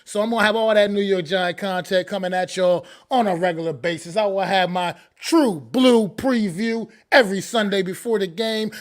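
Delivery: ordinary delivery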